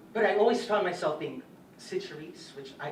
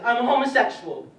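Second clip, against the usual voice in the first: loud, high-pitched declaration; bold announcement; 7 semitones higher, 12 dB louder than usual. Retold or imitated. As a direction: blurt it out at full voice. Retells announcement to the principal.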